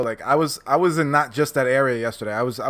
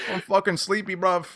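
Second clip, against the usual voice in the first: put-on a higher London accent, bruv stretched; London accent impression; 6 semitones higher than usual. Streamer still doing his London accent, high and cheeky. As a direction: keep up the mock London accent, a bit higher. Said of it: "a London fucking accent".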